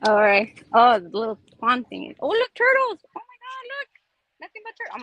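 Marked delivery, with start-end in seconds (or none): 2.2-5.0 high, delighted squeal at the turtles; thrilled, voice jumping up; excited. Real, not acted. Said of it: "I'm excited".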